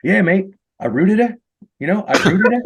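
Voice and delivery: high, loud Aussie bloke bragging; a Sydney bartender bragging to a new coworker, Aussie accent. Retold or imitated. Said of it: "pretty good, uh, Aussie accent".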